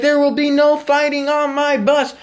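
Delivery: high, loud mock scolding; stern bus driver. Bus driver scolding the kids, mock stern and loud. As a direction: lay down the law like the driver. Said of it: "She's all like".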